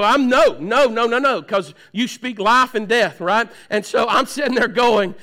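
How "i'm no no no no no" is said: loud denial. Retold or imitated. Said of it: "I just walk around and go".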